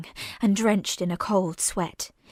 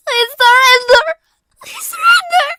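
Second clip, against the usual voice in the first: high, loud cracking cry, slurred; 11 dB louder than usual. Acted. Girl cries her surrender again, in English, voice cracking. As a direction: plead in tears, voice breaking. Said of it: "she cried, voice cracking".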